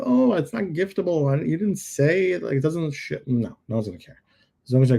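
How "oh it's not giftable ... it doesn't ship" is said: high, whiny imitation of a buyer; a touch of a picky shopper; mock buyer. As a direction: lightly play a fussy customer complaining. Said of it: "they're like".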